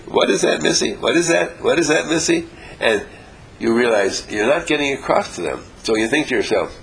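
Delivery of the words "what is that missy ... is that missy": high, puzzled students asking; lightly voiced baffled students. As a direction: mimic confused pupils questioning. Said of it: "they say".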